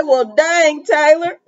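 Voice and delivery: high teasing "well, dang"; mock scolding reply to Taylor. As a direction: playfully scold a friend.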